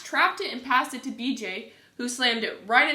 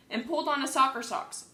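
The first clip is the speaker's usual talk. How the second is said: usual speaking voice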